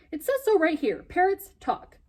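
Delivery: high, loud, pointed insistence; a boy showing the bird the manual; insistent. Acted. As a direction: prove it to the stubborn bird.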